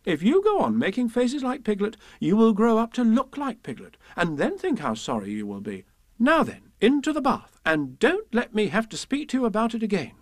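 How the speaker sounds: high, scolding mother; firm, swooping motherly telling-off; rather severe. Acted. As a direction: lecture a naughty child. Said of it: "said Kanga rather severely".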